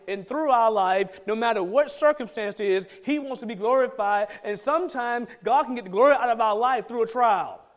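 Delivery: loud, rising preacher's drive; full-force preaching. Acted. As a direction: preach it out to the congregation.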